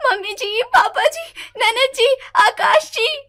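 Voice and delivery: loud, high calls to the whole house; calling out loudly. Acted. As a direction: shout each name in alarm. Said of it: "Neha चिल्लाते हुए सबको बुलाती".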